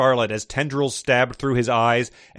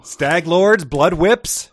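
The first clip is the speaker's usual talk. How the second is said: higher and louder, mock disbelief; incredulous; 6 semitones higher, 6 dB louder, much slower than usual. Real, not acted. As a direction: scoff at the silly names. Incredulous at silly fantasy terms.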